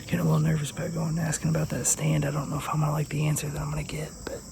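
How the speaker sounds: hushed hunting-stand whisper. Real, not acted. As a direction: keep it whispered so the deer don't hear.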